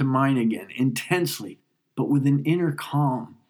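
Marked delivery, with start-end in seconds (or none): none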